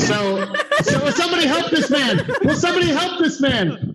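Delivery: high, laughing call for help; hammed-up cry for help, laughing; laughing mock plea. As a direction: player laughing while his character calls out.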